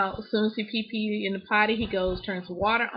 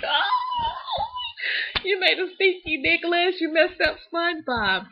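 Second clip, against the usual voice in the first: retelling finding the mess, crying out high; dismayed cry; 8 semitones higher, slower than usual. Retold or imitated.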